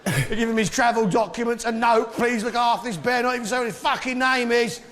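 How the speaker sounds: high-pitched, worked-up comic indignation; mock outrage. Comedian ranting to the audience about Peru's bears, mock-outraged.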